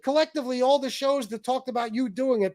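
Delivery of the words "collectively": usual speaking voice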